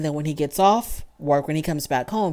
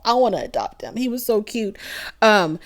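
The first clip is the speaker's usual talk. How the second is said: ordinary talk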